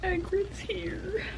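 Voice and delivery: almost crying, squeaking it out; choked-up whisper. Real, not acted. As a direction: fan fighting tears over Hagrid's arrival. Emotional moment in the book.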